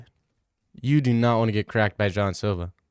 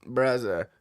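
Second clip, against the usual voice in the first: Islam impression; 5 dB quieter than usual. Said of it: "that a good Islam impression?".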